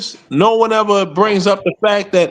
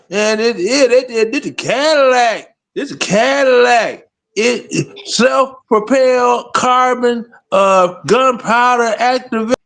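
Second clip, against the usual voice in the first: higher, hammed-up Baltimore accent of Snoop; Snoop Pearson's Baltimore accent; 5 semitones higher than usual. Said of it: "listening to her, that accent is fire".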